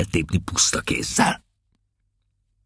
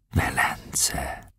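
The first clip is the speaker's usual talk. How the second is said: whisper; 5 semitones lower, 11 dB quieter than usual. Acted. Captain whispering the word.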